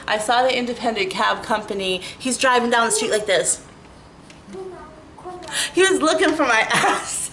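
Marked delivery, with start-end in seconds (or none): 5.7-7.3 laughing. Real, not acted. Laughs at driver searching.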